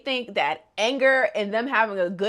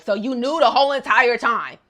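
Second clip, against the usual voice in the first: angry; 3 semitones higher, 6 dB louder than usual. Retold or imitated. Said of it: "Koji was just like".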